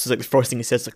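ordinary delivery

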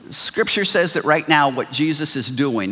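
ordinary talk, nothing marked

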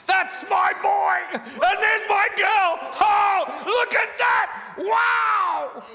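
high, loud, thrilled shout of a proud father; excited proud father. Acted. Heavenly Father cheering his children, high excited shouting. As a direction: cheer them on like a beaming dad. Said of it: "your Heavenly Father gets just as excited".

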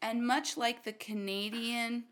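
ordinary delivery

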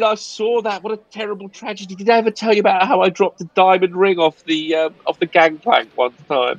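high-pitched, lamenting character voice; fussy Philpott. Acted. Philpott consoles Miss Stein over her loss, high mournful fussing. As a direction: gush with theatrical sympathy. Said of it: "Philpott comes over and he says".

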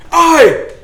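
high, loud alarm cry; guards shout. Acted. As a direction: shout to raise the alarm on the murderer. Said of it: "The guards shout".

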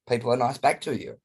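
ordinary delivery

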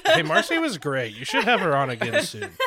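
high, laughing mock listener voice; laughing listener quote. Mimicking listener messages, laughing.

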